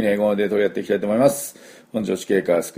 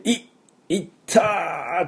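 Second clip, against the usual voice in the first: higher ow after banging a knee; pained yelp; 9 semitones higher, much slower than usual. Retold or imitated. Teacher imitating a yelp after hitting a knee. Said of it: "膝とかぶつけて".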